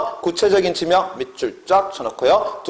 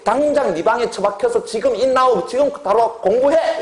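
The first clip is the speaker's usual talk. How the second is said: high, insistent mock scolding; 다그치는 엄마; 8 semitones higher than usual. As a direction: order a kid to study like a fed-up mother, voice raised in pitch. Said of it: "이런 의미잖아". (높은 톤으로 다그치는 엄마 흉내)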